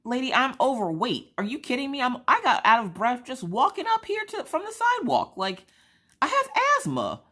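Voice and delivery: high-pitched mock indignation; comically exasperated comeback; indignant retort. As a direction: protest incredulously, pitch way up. Retelling her indignant reply to screener.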